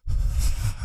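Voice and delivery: long unvoiced sigh of release; acted sigh. Woman finally touched after a while, long sigh. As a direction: let out a mock-blissful breath. Acting out her climactic relief.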